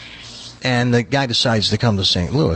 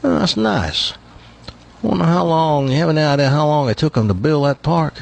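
higher-pitched bragging Texan; Texas accent; 4 semitones higher than usual. Acted. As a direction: play the nosy Texan visitor. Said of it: "He's one of these Texans that brags".

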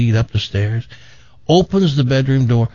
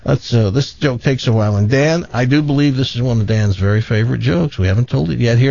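ordinary talk

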